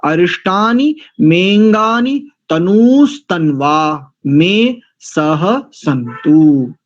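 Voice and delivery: slow recitation, मेंगानी fused; teacher modelling the mantra slowly; pronunciation demo. Shown as a demonstration. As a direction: carefully recite अरिष्टानी मेंगानी तनूस्तन्वा. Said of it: "मैंने कई बार करके दिखा दिया".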